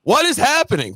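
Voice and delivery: sudden high-pitched "what is happening"; stunned disbelief. Retold or imitated. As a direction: jump way up in pitch, baffled. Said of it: "I'm like".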